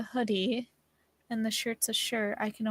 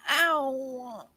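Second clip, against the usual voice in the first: long drawn-out "ow" sliding down in pitch; hammed-up falling yelp; mock cry of pain. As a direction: wail a playful ow as it falls away. Acts out being knocked down.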